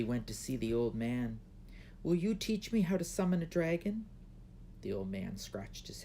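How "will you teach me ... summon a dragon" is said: put-on higher, pleading young girl; lightly voiced shy princess asking. A narrator's reading. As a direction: princess begging the old man to teach her. Princess asks.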